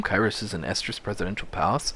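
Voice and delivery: ordinary delivery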